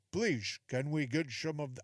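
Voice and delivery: put-on made-up old Pilgrim accent; badly faked Pilgrim accent, pleading politely; silly Pilgrim accent. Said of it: "I don't know what accent they used. It's silly".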